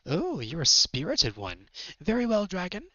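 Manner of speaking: high, haughty villain voice; slow, lofty horse warrior accepting a challenge; Nightmare. Acted. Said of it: "Nightmare turns to face him".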